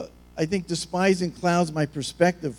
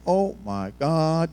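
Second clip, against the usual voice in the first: mock freak-out; much slower than usual. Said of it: "they're, like, freaking out".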